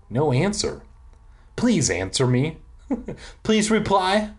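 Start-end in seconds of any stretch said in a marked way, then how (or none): 1.5-4.3 pleading, climbing higher on the repeat; understated plea, rising in pitch. A narrator's reading. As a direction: beg lightly for a reply. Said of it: "I repleaded".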